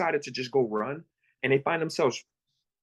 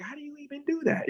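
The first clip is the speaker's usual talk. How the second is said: high, softly baffled question; mock puzzlement, high-pitched; retold bewilderment; 13 semitones higher, 4 dB quieter than usual. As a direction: imitate a clueless beginner, voice pitched up. Retelling a clueless beginner's question.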